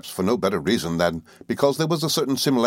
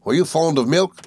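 milkman voice; 8 semitones higher than usual. A narrator's reading. Milkman questions girl.

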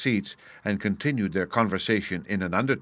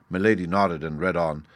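plain reading voice

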